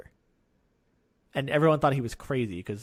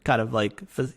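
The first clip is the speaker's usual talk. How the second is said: normal talking voice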